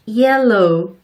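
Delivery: yellow said slowly, a drawn-out 이 glide into elo; long y in yellow. Shown as a demonstration. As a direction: lengthen the y: i-ello. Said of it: "이렇게 길게 발음해주세요".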